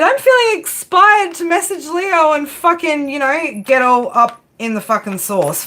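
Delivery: high, drawn-out mocking voice; mock inspired ex. Person from the past itching to message Leo, mock inspired. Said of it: "They're feeling inspired".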